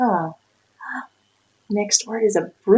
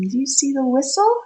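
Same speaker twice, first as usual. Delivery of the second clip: ordinary delivery, nothing marked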